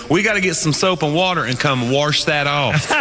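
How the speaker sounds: slow Southern pilot, saying "wash" as "warsh"; thick Southern accent. Retold or imitated. Comic as pilot proposing cleanup, thick Southern accent. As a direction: drawl it like a Southern captain. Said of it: "he had the thickest Southern accent".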